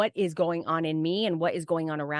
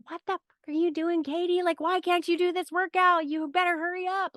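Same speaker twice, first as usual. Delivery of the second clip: pitched-up mock drill sergeant tone; mock ego yelling; 11 semitones higher than usual. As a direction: imitate a nagging ego voice pushing a workout. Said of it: "She doesn't yell at me".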